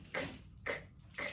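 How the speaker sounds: tiny voiceless "k" clicks; isolated letter sound. Shown as a demonstration. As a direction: click out "k" with no vowel. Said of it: "los sonidos".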